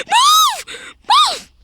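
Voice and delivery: high frantic shout; panicked scream. Real, not acted. Panicked, character stuck.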